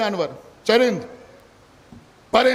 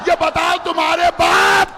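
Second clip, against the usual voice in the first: fervent preaching; 4 semitones higher than usual. Real, not acted. Preacher to the majlis gathering, fervent full-force preaching.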